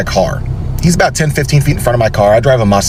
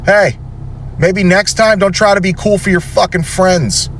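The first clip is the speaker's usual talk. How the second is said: scolding; 7 semitones higher than usual. Retold or imitated. Scolding kid from car window.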